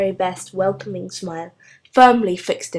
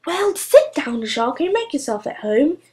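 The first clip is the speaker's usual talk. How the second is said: fussing; 6 semitones higher than usual. A narrator's reading. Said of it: "fussed Pongwithy".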